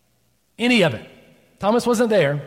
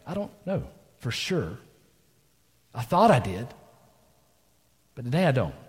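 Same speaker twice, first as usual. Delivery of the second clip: voiced Thomas, doubting and jaded; a lost believer questioning God. Preacher voices Thomas, doubting and jaded. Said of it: "doubting, jaded by life".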